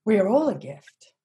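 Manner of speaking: choked up and lifting; a real catch of emotion in the voice; close to tears. Real, not acted. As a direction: voice rising, on the edge of tears. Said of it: "You're gonna make me cry".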